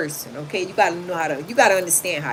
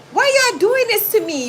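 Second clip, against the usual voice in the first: much higher mock whine; fake crying; 14 semitones higher, 6 dB louder than usual. Fake crying to the officers, high whine. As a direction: put on a fake sob. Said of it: "stop fake crying".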